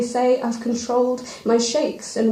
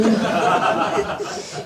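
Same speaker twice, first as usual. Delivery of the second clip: brief embarrassed chuckle; 4 semitones lower than usual. Real, not acted. Laugh after misjudged situation.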